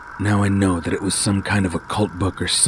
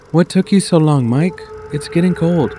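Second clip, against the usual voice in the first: higher, louder mother calling; mom voice; 10 semitones higher, 6 dB louder than usual. Acted. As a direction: call him to dinner like mom. Narrator voices mom calling son.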